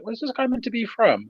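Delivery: high, puzzled mock question; baffled question. Friend retelling his confusion at the accent, high and puzzled. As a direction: act baffled by the odd accent. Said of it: "I was like".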